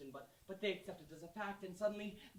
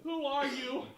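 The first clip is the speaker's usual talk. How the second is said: higher, louder, pleading; 6 semitones higher, 11 dB louder, much slower than usual. Retold or imitated. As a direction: blurt the question out to her. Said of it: "One day you say it out loud".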